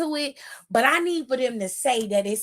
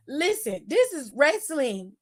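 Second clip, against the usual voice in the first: usual speaking voice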